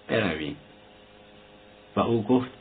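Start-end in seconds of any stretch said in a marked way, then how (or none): 0.1-0.5 quiet, lower «برویم» with a falling close; low aside. A narrator's reading. Doctor quietly urging Razumikhin to leave. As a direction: murmur «برویم» as a hint. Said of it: "زوسیموف با سر به رازومیخین اشاره کرده گفت".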